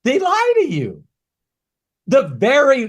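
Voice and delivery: high loud indignant outburst; ranting. Real, not acted. Ranting to Ola about lying recruiters, loud indignant outburst. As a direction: explode on lie. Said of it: "I'm on a rant here".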